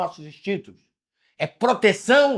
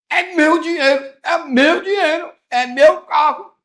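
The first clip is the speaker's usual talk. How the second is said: high, slow, put-on whiny voice clinging to money; mock selfish miser; 12 semitones higher, 5 dB louder, slower than usual. Preacher mocks a materialist to the congregation, high whiny caricature. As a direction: caricature a greedy man guarding his things, higher and slower. Mocking a selfish, attached person.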